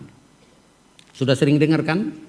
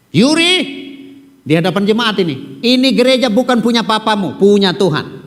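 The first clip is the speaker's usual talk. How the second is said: stern public warning; 4 semitones higher, 6 dB louder than usual. Acted. Repeats the warning to Yuri.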